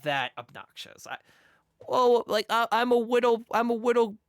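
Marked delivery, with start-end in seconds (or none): 1.9-4.1 lightly voiced whiny kid, louder; mocking a cutesy kid character. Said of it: "Blah, blah, blah".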